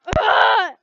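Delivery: loud, high, frustrated groan; frustrated ugh shout. Acted. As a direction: let out an exasperated yell. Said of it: "I exclaimed".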